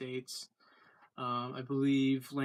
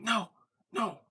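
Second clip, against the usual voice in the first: higher-pitched, firm retold "no"; short, raised-voice mock scolding; scolding a dog; 8 semitones higher, 6 dB louder than usual. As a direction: imitate someone telling off a dog. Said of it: "disciplining the dog".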